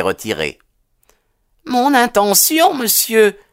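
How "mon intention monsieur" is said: high, earnest woman's voice; troubled mother. A narrator's reading. Mother resuming her statement to the detective, earnest and high. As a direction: begin the confession, pitched high. Narrator plays the mother confessing.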